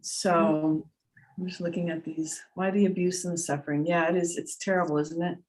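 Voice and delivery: normal talking voice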